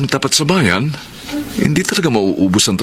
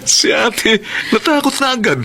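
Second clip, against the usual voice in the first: teasing; slower than usual. Acted. Teasing sister after joke.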